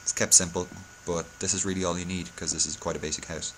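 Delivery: ordinary delivery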